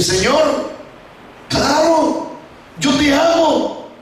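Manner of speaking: high, stretched-out declaration; soaring, heartfelt reply; fervent avowal. Acted. Peter professes love a second time.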